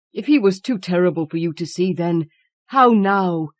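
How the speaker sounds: a mild tremble of dread; husband pleading with his wife, voice shaking. A narrator's reading. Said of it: "and his voice shook".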